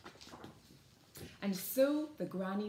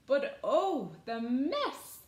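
loud, raised wail of dismay; dismayed cry; 6 semitones higher, 7 dB louder, slower than usual. A narrator's reading. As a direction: lament it theatrically. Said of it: "The baker cried".

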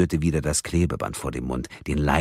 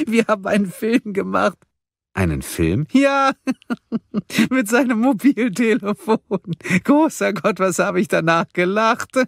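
high, louder giggling schoolgirl voice; 5 dB louder than usual. Acted. Karen giggling to Mia about the fake film, schoolgirl laughter. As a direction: giggle through every word like a naughty girl. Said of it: "kicherte und lachte wie ein kleines Schulmädchen".

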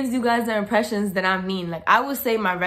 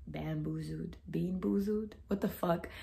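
whispered, bewildered aside to herself; confused mutter; 12 dB quieter than usual. Real, not acted. Vlogger confused by a comment, whispering to herself. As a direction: mutter it under your breath.

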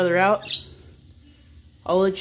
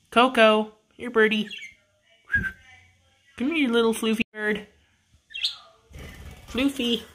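higher coaxing voice; playful raised cooing; pet talk; 7 semitones higher than usual. Real, not acted. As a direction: call a little bird over sweetly, pitched up. Coaxing pet bird over.

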